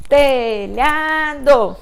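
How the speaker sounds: "Telhado" in three loud, drawn-out beats, te-lha-do; syllable by syllable. Shown as a demonstration. Teacher giving three little jumps with pupils, syllable by syllable. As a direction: stretch each syllable of "Telhado" as if hopping on it. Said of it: "Vamos então dar três pulinhos".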